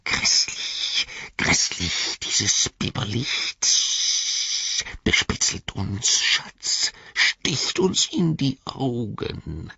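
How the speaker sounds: slow, sibilant whispery complaint; hissing creature. A narrator's reading. Gollum stung by the light, hissing. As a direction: draw out every hiss in disgust. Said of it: "zischte er".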